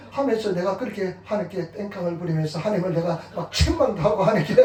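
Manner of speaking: usual speaking voice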